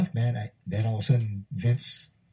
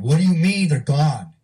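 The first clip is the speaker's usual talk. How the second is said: louder and slow, voice rising in shock; retold shock, louder than the first ask; louder disbelieving question; 5 semitones higher, 7 dB louder, slower than usual. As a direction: demand it again, stunned and pushing. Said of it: "He said".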